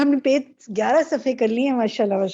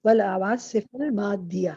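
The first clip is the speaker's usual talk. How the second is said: slow model reading; 3 semitones lower, slower than usual. Shown as a demonstration. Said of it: "teacher models the correct reading".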